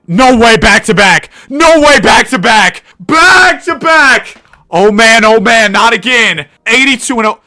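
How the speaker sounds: high, loud victory yells; ecstatic shouting. Real, not acted. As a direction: scream in disbelief at winning twice. Celebrates back-to-back perfect seasons.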